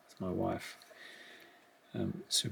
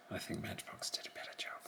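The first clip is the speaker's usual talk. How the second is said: soft unvoiced aside; whispered aside; 15 dB quieter, faster than usual. Real, not acted. Collector judging a model car to himself, whispered. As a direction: whisper it, barely voiced, as a private judgment.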